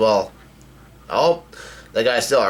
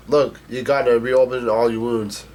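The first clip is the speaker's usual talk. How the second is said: plain reading voice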